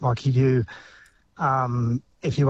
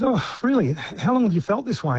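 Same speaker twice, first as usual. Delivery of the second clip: a lightly voiced doctor, higher and mock-interested; doctor in a joke; 6 semitones higher than usual. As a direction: play the doctor, pitched up and curious.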